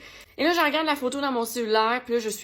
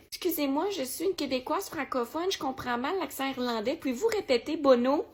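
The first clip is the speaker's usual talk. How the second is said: softer, slightly raised polite voice; 3 semitones higher, 3 dB quieter than usual. Pretend. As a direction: make a gentle, courteous request to a star. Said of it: "vous pouvez être poli et juste lui dire". (demande polie, tout en douceur)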